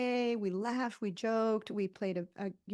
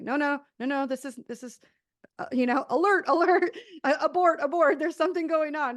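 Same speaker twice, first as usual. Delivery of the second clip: higher and louder, urgent panic; inner child alarm; 7 semitones higher, 8 dB louder than usual. Retold or imitated. Inner child shouting alert and abort at the peaceful evening, loud high panic. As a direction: sound the alarm like a frightened kid. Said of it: "my inner child within me is like".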